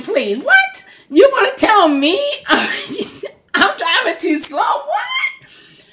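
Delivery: high, loud laughing outburst; laughing disbelief. Real, not acted.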